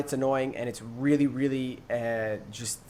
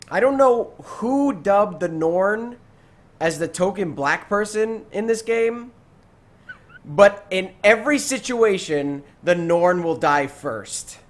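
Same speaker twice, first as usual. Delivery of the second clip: mock rant; 5 semitones higher, 5 dB louder, slower than usual. Real, not acted. Ranting joke about Norn.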